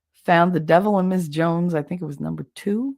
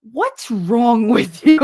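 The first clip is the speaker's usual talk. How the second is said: slow, louder, incredulous; mock baffled friend; 6 semitones higher, 7 dB louder, slower than usual. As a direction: drag it out in disbelief. Friend's baffled reaction, retold.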